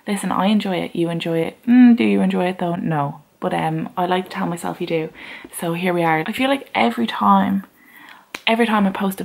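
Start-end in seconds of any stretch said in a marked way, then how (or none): none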